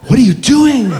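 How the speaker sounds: sudden incredulous outburst; alarmed inner voice. Acted. Dad scolding himself in his head, alarmed. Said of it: "right away in my head, I'm like".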